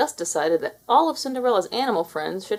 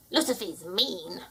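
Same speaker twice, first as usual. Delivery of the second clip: mouse warning; 5 dB quieter, slower than usual. A narrator's reading. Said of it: "Jack warned".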